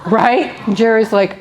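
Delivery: normal talking voice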